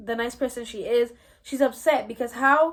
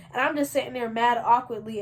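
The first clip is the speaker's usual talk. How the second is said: ordinary talk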